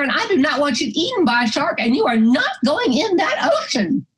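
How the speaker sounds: high-pitched, indignant forbidding; scolding wife. Retold or imitated. Missy grabbing her husband's arm, scolding him in mock outrage. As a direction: act out a wife laying down the law.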